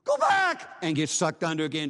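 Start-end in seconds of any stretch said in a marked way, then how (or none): 0.0-0.7 desperate scream, much higher and louder; screamed warning. A narrator's reading. Drowning teen screams to warn his friend, desperate shriek. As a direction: shriek it like your life depends on it. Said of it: "scream to his friend".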